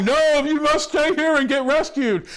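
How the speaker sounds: high, pompous put-on voice; mock purser. Imitating the purser arguing with the preacher, mock officious. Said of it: "But the purser, this fat dude, he's like".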